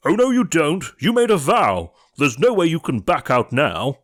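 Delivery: high, loud, bossy shriek; bossy ugly sister. A narrator's reading. Ugly sister bossing the prince about his vow. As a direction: nag him like a shrew.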